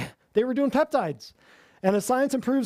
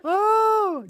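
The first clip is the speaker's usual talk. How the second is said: exclaiming; 13 semitones higher, 5 dB louder than usual. Real, not acted. Loud high exclamation.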